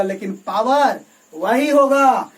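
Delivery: loud, drawn-out stress on "power वही"; emphatic key point. Real, not acted. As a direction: hammer "power वही होगा" slowly and loudly. Teacher hammers home the key point. ("Power वही" पर धीरे, ज़ोर देकर)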